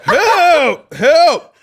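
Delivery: high, loud panicked dad voice, laughing through it; hammed-up alarmed father; frantic dad. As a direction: play the freaked-out father yelling. Dad finding son on floor.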